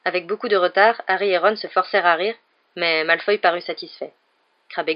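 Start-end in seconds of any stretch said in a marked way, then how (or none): none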